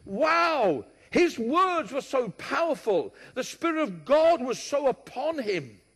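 high, loud exclamation of wonder; rejoicing. Acted. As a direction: proclaim it as an awed, rejoicing crowd would. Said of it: "And with that we rejoice".

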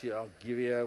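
low, cross London dad voice; mock-threatening parent in broad London accent. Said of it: "I'd go back into London".

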